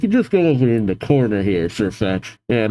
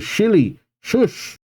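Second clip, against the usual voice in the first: higher-pitched professor's hushing; mock scolding; 8 semitones higher, slower than usual. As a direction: cut the chatterbox off with a shush. Professor hushes the excitable girl.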